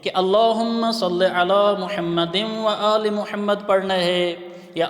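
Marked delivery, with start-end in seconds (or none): 0.1-3.7 measured recitation without pausing; slow devotional recitation. Shown as a demonstration. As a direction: recite slowly in one breath. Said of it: "یہ سوال ہوتا ہے".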